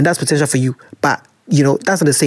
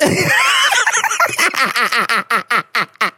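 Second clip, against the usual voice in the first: shrieking laugh; 5 semitones higher than usual. Real, not acted. Laughs at scripture line.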